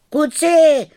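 loud, high-pitched call; shouting out. A narrator's reading. Vojta calls the boys on the ice, high shout. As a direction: holler across the pond to the gang. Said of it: "rozkřikl se Vojta".

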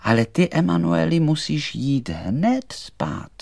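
high voice, slowing at the end; poppy doll. Acted. Doll sending butterfly Emanuel to bed, high and firm. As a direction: send him to bed, drawing out the last words.

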